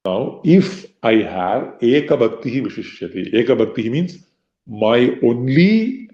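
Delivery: normal talking voice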